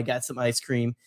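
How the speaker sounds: ordinary delivery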